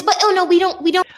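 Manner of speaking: high, mildly flustered mock objection; a hint of high-pitched whiny refusal; protesting dad. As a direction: lightly imitate a fussy dad cutting in. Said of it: "He goes".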